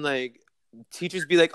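ordinary delivery

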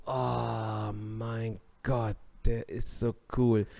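drawn-out, slow awestruck gushing; mock awe. Everyone reacting to the son, mock awe. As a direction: stretch it out like a swooning fan. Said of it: "Und alle so".

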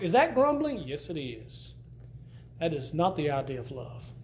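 usual speaking voice

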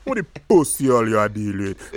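mock Jamaican patois cussing; inner Yardie accent. Co-host showing Leon what to say, Jamaican Yardie accent. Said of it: "Leon needs to channel his inner Yardie".

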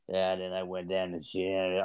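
low, soft, slow mumble; talking to himself. Retold or imitated. Imitating the sketchy washroom guy, mumbled self-talk. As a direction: imitate a man muttering away to himself. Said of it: "like, talking".

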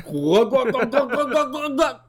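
high-pitched rapid glugging; gulping noises. Retold or imitated. Drinker downing a pint before leaving, comic glugging. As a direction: imitate chugging a pint, rising in pitch.

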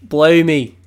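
hammed-up Australian shout; mock Australian accent. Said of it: "Australians trying to pronounce some word".

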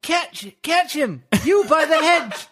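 high, very slow calling voices; crowd voices. Acted. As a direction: call out after the falling lizard, laughing.

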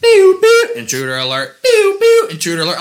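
very high, loud beeping alarm; alarm imitation. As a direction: imitate a shrieking house alarm. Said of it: "all I hear is".

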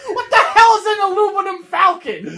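high, loud indignant quote; mock outrage. Cohost quoting the falcon line, loud mock outrage. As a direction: shout it in mock disbelief.

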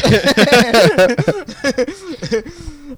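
a big, high burst of laughter; loud laugh. Real, not acted. Cracking up while telling his friend the Tinder story, loud laugh. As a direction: crack up at your own story.

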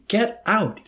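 high, loud hysterical order; hysterical yell. A narrator's reading. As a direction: yell it in desperation. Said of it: "Yossarian yelled hysterically".

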